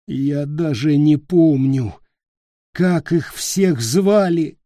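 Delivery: slow moaning lament; groaning. A narrator's reading. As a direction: moan it out in despair. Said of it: "простонал он".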